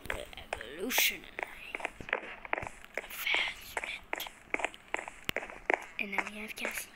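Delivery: hushed nature-documentary narration; documentary whisper. Pretend.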